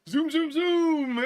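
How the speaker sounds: high, loud, slow drawn-out zoom; a hammed-up, leering camera zoom; eager zoom. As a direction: stretch each zoom out eagerly. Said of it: "And he's like".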